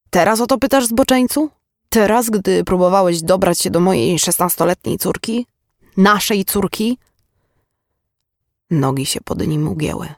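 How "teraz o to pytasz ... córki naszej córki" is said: higher-pitched, indignant rebuke; outraged. Acted. Mother confronts father of her daughter, outraged. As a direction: throw the question back at him in outrage.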